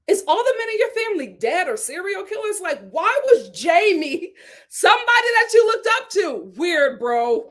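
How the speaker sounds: high, loud scolding questions; exasperated disbelief. Pretend. Host grilling Romeo about idolizing Jamie, exasperated disbelief.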